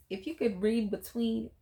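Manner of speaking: usual speaking voice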